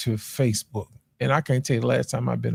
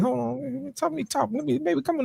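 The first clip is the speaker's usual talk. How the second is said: much higher, softer voice; hushed eager wooing; 12 semitones higher, 7 dB quieter than usual. Acted. Trying his translated Portuguese on the women, high soft wooing. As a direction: plead softly, up high.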